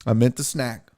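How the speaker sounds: normal talking voice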